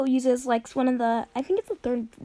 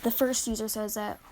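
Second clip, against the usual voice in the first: ordinary delivery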